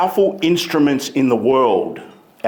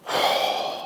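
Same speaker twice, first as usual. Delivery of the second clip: a long unvoiced defeated sigh; weary sigh; 7 dB quieter than usual. Shown as a demonstration. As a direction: sigh out like you've given up. Slumped speaker demo.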